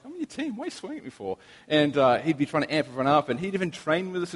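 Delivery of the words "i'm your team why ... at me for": high, soft mock whine; mock protest.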